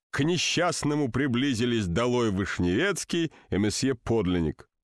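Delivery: ordinary delivery, nothing marked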